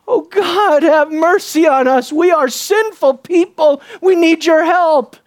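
high, pleading cry for mercy; acted penitent plea; repentant cry. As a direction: beg God earnestly for help, drawing it out. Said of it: "That's the cry we long to hear".

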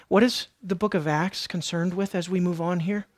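ordinary talk, nothing marked

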